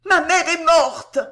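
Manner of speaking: high, loud, slow wail; desperate cry. A narrator's reading. Boy hearing his mother's scream, desperate cry. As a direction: scream out in despair. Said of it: "L'enfant répondit par un cri désespéré".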